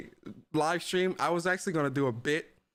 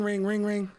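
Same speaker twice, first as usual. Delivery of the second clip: imitated phone ring; 5 semitones higher, slower than usual. Streamer mimics the phone ringing for the CMA bit, high sing-song ring. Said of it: "So I go, ring".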